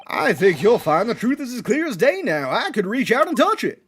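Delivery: lilting, emphatic character voice; confident juror. Acted. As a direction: proclaim it with bouncy conviction.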